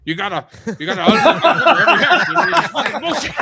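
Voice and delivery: high, loud laughing rant; Joe the Lawyer impression. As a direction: rant like a furious lawyer, cracking up. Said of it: "I gotta do my Joe the Lawyer impression".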